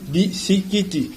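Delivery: "bisikiti" slowly, syllable by syllable; Lingala pronunciation. Shown as a demonstration. Teacher giving the Lingala word, "bisikiti" in slow syllables. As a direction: spell out "bisikiti" by ear, each vowel sounded. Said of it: "À lingala, on dit quoi?".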